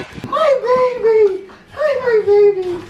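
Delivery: puppy voice. Real, not acted. Greeting the puppy again.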